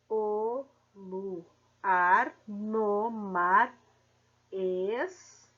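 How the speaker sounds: very slow, each syllable pulled apart. Shown as a demonstration. Teacher splitting the verse into syllables, very slow. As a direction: spell it out syllable by syllable. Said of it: "fazendo a separação silábica".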